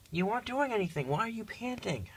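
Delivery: high, soft coaxing voice to a cat; high cooing at the pet; pet talk. Real, not acted. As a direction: coax the cat gently, way up high. Asking cat why panting.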